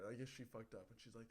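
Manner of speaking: usual speaking voice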